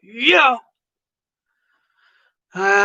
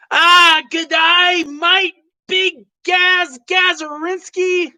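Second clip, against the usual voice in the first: much higher and louder, stretched slowly; hearty welcome; 9 semitones higher, 4 dB louder, slower than usual. Acted. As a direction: roll out the names slowly and loudly. Hammed greeting to Aussie guest.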